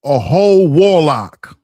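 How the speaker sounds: slow, loud, deep declaration; booming accusation. Acted. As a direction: proclaim it like a revelation. Dramatic accusation.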